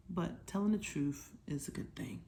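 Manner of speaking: put-on soft whispered secret to the kids. Teacher whispers the lesson's moral to her young listeners, hushed. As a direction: let it drop to a hush, like sharing a secret.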